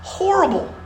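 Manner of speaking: exclaimed high and sharp; a pained cry of horror; horrible. Real, not acted. Preacher stressing the severity, high and pained.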